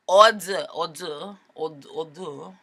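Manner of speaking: put-on playful, slow sexy French accent; put-on sultry French accent. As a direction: purr it in a mock French accent. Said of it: "I wanna sound French and sexy".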